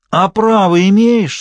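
loud, high, stretched-out challenge; defiant. A narrator's reading. Vitka challenges Genka's right to judge, loud and defiant. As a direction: throw the question out slowly and defiantly. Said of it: "с вызовом спросил Витька".

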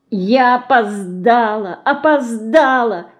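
slow, drawn-out despair; girl thinking in despair. A narrator's reading. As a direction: let the words sink in anguish. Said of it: "с отчаянием думала Динка".

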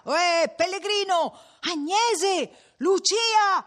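high, loud searching calls; calling out. A narrator's reading. Villagers calling the pilgrim and the missing women, calling out. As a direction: hail people who may be hidden.

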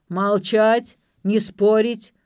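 loud, forceful outburst; howled order. A narrator's reading. Drunken father silences any argument, loudly bellowing. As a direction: punch out each command. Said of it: "взвыл пьянчуга".

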